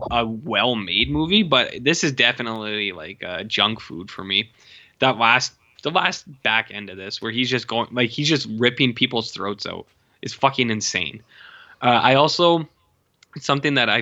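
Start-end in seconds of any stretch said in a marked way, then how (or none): none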